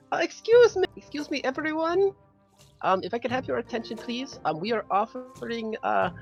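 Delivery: squeaky pony making a polite announcement; little pony voice. Acted. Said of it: "in my loudest little pony voice".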